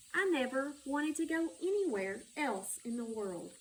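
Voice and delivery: lilting read-aloud storytelling voice; story narration. Acted. Narrator reading to children, gently lilting storytelling. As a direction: read it like bedtime to little ones. Reads picture book aloud.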